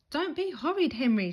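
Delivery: high, loud angry shout. A narrator's reading. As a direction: shout him down. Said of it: "she shouted".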